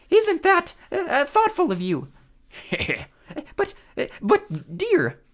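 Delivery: very high squeaky stammering; nervous falsetto. A narrator's reading. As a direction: stammer in a fake bride voice. Grover as nervous bride.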